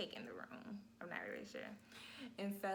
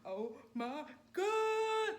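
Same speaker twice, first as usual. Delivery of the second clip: very slow, high, loud groan; mother lying back overwhelmed, a long loud moan; drawn-out moan; 12 semitones higher, 7 dB louder, much slower than usual. Retold or imitated. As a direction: stretch it out in agony. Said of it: "laying back on that bed like".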